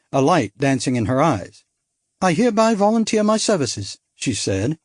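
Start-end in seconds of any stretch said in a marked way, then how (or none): 2.2-4.0 put-on lighter, higher bright young woman; spirited society girl, higher; young woman character. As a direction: play her as a bold upper-class girl. Narrator voices young woman.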